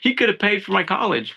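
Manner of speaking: high-pitched indignant protest, not shouted; exasperated, voice shooting up; mad. Retold or imitated. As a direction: replay confronting mom in outraged disbelief. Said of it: "I was mad".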